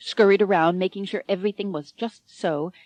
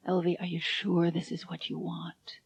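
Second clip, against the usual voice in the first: quiet, caring question; gentle; 8 dB quieter than usual. A narrator's reading. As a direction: ask her gently, holding her hands. Harvey asks gently.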